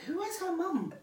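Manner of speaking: lightly acted crowd voice; imitating onlookers. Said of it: "then everyone was like".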